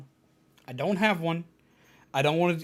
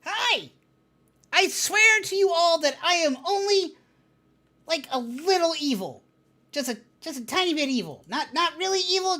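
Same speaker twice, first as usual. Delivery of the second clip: higher, shaky fake-innocent voice; nervous little villain; 7 semitones higher than usual. VTuber character insists it is harmless, flustered high voice. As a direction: protest too much, flustered.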